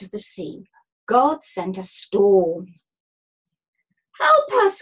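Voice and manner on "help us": high, loud cry for help; panicked cry. A narrator's reading. Sailor crying for help in a storm, panicked. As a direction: cry out in alarm. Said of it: "cried a sailor".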